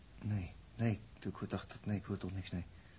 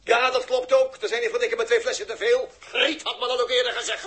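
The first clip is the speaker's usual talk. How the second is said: flustered laugh; 13 semitones higher, 7 dB louder than usual. Acted.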